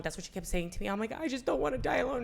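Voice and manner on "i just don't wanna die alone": higher-pitched plea to the aunt; a touch of tearful fear, retold; mild retold distress. Telling her aunt of dying alone, mild retold distress. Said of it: "I could not stop crying".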